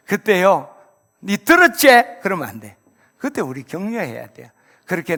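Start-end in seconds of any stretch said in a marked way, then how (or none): 1.2-1.9 비꼬는 말투. Acted. Mocking spouse's gloating retort.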